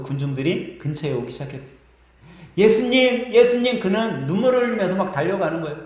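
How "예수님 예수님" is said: high, loud call while running in tears; tearful calling out. A narrator's reading. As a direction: cry out his name, reaching for him. Runs crying toward Jesus. (울면서 달려가며 부르는 소리)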